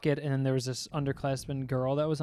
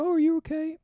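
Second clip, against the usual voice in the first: high-pitched mock concern; concerned onlookers; 13 semitones higher than usual. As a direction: imitate worried people, voice way up. Imitates concerned audience after fall.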